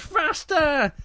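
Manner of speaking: high, excited call; eager cub's cry. Acted. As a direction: urge on the swing pusher. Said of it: "and he goes".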